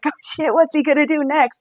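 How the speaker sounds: on the edge of your seat; gleeful dread. Retold or imitated. Viewer's gleeful dread quote.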